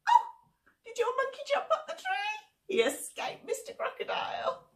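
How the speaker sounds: very high delighted squeal; excited praise. Acted. Leader cheering the children for escaping. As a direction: cheer the kids on.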